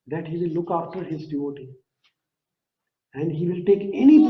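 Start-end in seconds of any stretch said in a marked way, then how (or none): none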